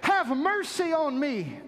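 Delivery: high, pleading cry, stretched on mercy. Retold or imitated. Blind Bartimaeus crying to Jesus, desperate. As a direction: call out desperately to be heard. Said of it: "He cries out".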